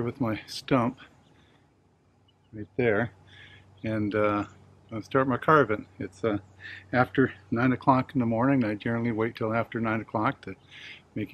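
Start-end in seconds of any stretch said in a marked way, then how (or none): none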